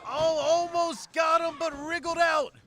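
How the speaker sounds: excited, loud ringside play-by-play; wrestling commentator. Acted. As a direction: call the action as it happens.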